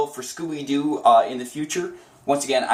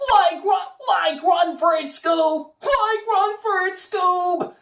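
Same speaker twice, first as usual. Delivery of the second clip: Shaggy voice; slower than usual. Shown as a demonstration. Demo repeated, high Shaggy urging Scooby to flee. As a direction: shout it high like a scared hippie. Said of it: "that's all factoring into the acting".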